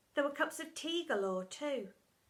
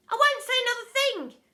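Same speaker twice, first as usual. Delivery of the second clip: much higher, loud plea; panicked child; 12 semitones higher, 12 dB louder than usual. Acted. Pupil promising silence to Miss Seeth, panicked. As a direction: promise it desperately.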